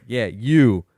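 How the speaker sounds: single him out in the crowd; mock shout; calling out.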